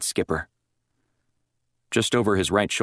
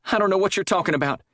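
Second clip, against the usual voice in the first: rushed, high nervous denial; 12 semitones higher, faster than usual. A narrator's reading. Sandy caught by the chaplain, nervous rushed denial. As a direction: deny it fast, nervously. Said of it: "she said nervously".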